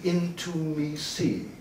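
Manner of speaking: "into me see" slow and soft, word by word; word broken apart. Shown as a demonstration. Speaker revealing their interpretation of intimacy, slow soft "into me see". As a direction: stretch "into me see" out quietly as separate words. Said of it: "This is my interpretation of intimacy".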